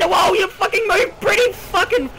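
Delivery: very high, loud shriek; startled scream. Real, not acted. Gamer shrieking as the creature charges. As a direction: shriek as it rushes in. Monster rushes him.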